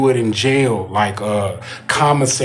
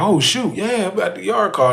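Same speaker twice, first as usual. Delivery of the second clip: eager inmate; 8 semitones higher than usual. Retold or imitated. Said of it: "and you like".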